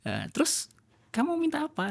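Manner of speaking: a gentle voice of the Creator speaking; suara Allah berbicara. Pretend. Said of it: "seolah-olah Allah mengatakan gini". (Allah berbicara kepada manusia, sedikit dibuat-buat)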